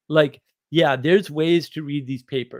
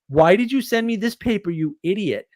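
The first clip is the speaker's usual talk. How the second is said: exasperated buddy; 7 semitones higher than usual. Retold or imitated. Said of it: "one of them was like".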